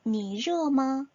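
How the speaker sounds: 니热吗 stretched out very slowly, each tone held; slow, careful Chinese demo; very slow Chinese model. Shown as a demonstration. Teacher models the question very slowly for learners. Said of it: "어떻게 대답해야 한다".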